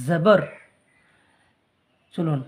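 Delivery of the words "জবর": জবর said loudly with a z sound, zabar; correct zabar, emphatic. Shown as a demonstration. Teacher repeats the correct z in জবর for students. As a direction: land the right জবর with a firm z. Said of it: "জবর বলবো".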